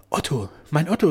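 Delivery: high, loud call to a beloved; urgent, emotional outcry; impassioned cry. A narrator's reading. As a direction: cry out to him fervently. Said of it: "rief sie".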